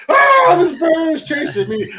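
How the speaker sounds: high, slow panicked squeal; mock screaming girl. Friend fleeing the bear, high mock panicked squeal. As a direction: squeal like a scared little girl. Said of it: "you're gonna be like".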